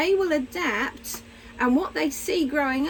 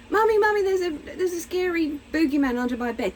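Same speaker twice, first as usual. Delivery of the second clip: put-on child calling mummy; 5 semitones higher than usual. Child calling mother, voiced.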